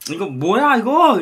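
hammed-up yell of disgust after the drink; shocked outburst. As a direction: spit it out like you just tasted something foul. Acting shock at a bad drink.

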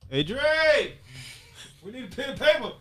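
high-pitched holler; calling out. Pretend. Host calling Dre for pen and paper, high holler. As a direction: call to someone across the room, playfully urgent. Calls out to someone for supplies.

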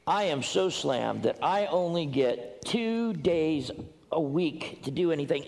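usual speaking voice

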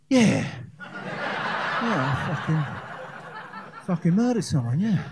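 mock menacing through laughter; laughing menace. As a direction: act tough while cracking up.

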